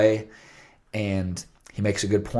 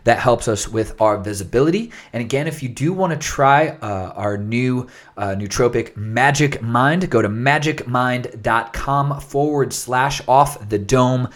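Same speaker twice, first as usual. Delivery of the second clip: higher, louder announcer-style plug; podcast outro read; 4 semitones higher, 5 dB louder than usual. Real, not acted. Host asking listeners for ratings and trials, podcast outro read. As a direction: punch out the sign-off like a promo.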